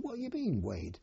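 jumps high in pitch on the first words, then falls; a sudden pitch leap of startled disbelief; retold surprise. Retold soldier to his pals, high startled question. Soldier hears of unexpected weighing.

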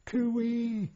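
high, drawn-out bush call; high-pitched Australian cooee; Aussie cooee call. Retold or imitated. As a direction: call it high across the hills. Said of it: "the old Australian call, you know, high-pitched".

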